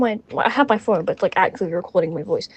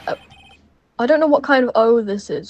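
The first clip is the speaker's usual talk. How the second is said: usual speaking voice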